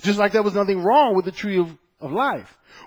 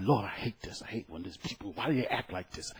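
muttered low and much softer; under my breath; 8 semitones lower, 12 dB quieter than usual. Retold or imitated. Cleaner grumbling to God about the messy house, under breath. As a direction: grumble it to yourself so nobody hears. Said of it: "under my breath".

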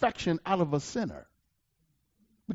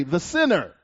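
"the sinner" pressed down with a falling tune; emphasis on sinner. Shown as a demonstration. Preacher reading scripture, emphasising "the sinner". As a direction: set "the sinner" apart with weight. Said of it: "Emphasis added".

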